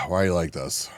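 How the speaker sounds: low, soft and fast, with a sigh; exasperated sigh at chat; sighing exasperation. Real, not acted.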